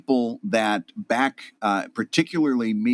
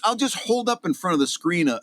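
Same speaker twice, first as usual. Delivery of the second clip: ordinary talk, nothing marked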